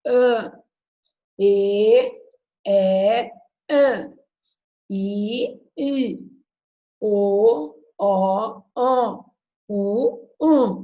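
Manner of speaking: vowels sounded out loud, some nasal; vowel sounds. Shown as a demonstration. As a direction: sing out each vowel sound. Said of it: "Se é fonema".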